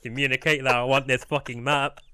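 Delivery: loud mock-angry outburst; frustrated rage. Bell ringer who can't communicate, loud frustrated voice. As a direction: push it out loud in comic frustration. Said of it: "he's doing that exact face".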